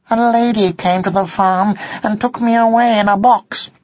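earnest small cat explaining; kitten voice. Acted.

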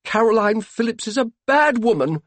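high, loud, indignant denunciation; spitting anger. A narrator's reading. Sadie denouncing Caroline Phillips to her husband, angry spitting. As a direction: spit out contempt for a rival. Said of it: "spat Sadie".

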